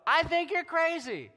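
high, loud and drawn out scorn; mocking judgmental voice. Church member scorning a struggling believer, mocking. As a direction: sneer it like a judgy churchgoer. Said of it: "who don't look at us and go".

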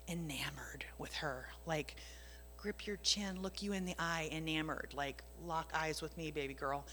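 hushed loving murmur; tender hush. Retold or imitated. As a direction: lean in close and coax her gently. Tender words from God.

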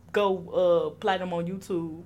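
normal talking voice

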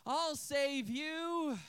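long-drawn, dutiful mock rescue call; half-hearted hero cry. Boy leaving watermelon for another rescue, half-hearted mock cry. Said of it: "takes a half-hearted swing at the dragon".

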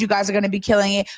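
ordinary talk

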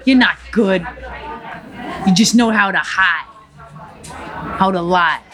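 put-on thickly accented gangster monologue; defiant, punchy Cuban tough guy; Tony Montana. Gangster addresses a crowd of onlookers, defiant character voice. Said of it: "to Tony Montana".

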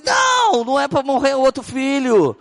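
alarmed high cry. Retold or imitated. Daughter offers to take illness.

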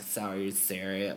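put-on soft, slow girl voice, lightly done. Shown as a demonstration. Comic showing a bit of the stage act, light girl voice. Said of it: "I do this girl voice".

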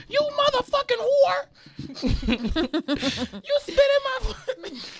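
screeching quote. Retold or imitated. Retelling a New York fight, high laughing screech. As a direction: shriek the insult in a cartoon falsetto. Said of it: "she said".

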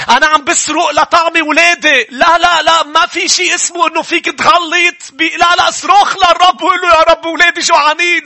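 high, loud, impassioned sermon; full-force preaching; fiery preaching. Acted. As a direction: drive repentance home at full force.

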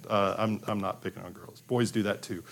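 usual speaking voice

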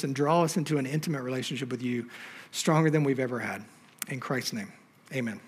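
quiet, low prayer voice. Acted. Pastor praying over the congregation before the sermon, quiet and reverent. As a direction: pray softly and reverently.

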